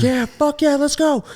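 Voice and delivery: high, loud mock cheer; imitating cheering teammate. Imitating his teammate cheering him on, high and loud. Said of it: "He's the biggest cheerleader".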